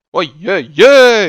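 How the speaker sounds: loud, slow, stretched "oi, oi, oi"; drawn-out dismay. Real, not acted. Confessing his bad English to viewers, loud drawn-out dismay. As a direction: groan it out like a self-mocking lament.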